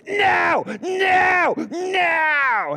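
high shouted no, no, no; little girl yelling no in a shopping cart; toddler screaming no. Retold or imitated. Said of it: "she was screaming at her mama".

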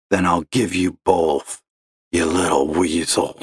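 a hint of gravelly sneer; a cranky caller sneering at the agent; surly gravelly caller. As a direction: grumble the insult. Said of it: "gravelly voice like that".

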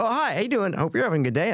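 high-pitched, friendly customer hello; friendly greeting. Retold or imitated. Acts out his warm reply to cashier.

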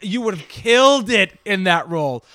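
loud, emphatic burst of praise; thrilled, loud outburst; excited gushing. Real, not acted. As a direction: gush at full volume, punching the key words. Gushing, interrupts guest with praise.

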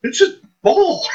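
very high-pitched mock panic; high, stunned disbelief, played for laughs; retold freak-out. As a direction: squeak it out as if freaking out. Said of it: "I'm just freaking out".

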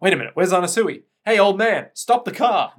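acted shout for attention; urgent calling out. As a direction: call urgently to the driver. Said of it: "Weather Report's like".

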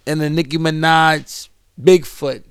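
ordinary talk

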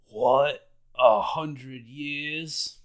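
a slow, drawn-out shocked question; mild mock shock at a hundred years; shocked disbelief. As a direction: stretch it out in disbelief. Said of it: "Luffy's kinda shocked".

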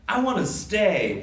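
high, drawn-out mock protest; huffy. A narrator's reading. Wendy huffs that she won't leave, huffy. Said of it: "Wendy huffs".

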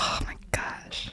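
soft breathy whisper. Real, not acted. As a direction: whisper it in awe. Hushed reaction to oatmeal talk.

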